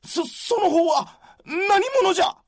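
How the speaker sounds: high, stammering alarm; jolted, stumbling on the first word; startled stammer. Acted. Startled warrior meets Yukimura.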